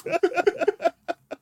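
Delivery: helpless high-pitched laugh; a burst of shrill giggling; high squealing laugh. Real, not acted. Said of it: "now look at him".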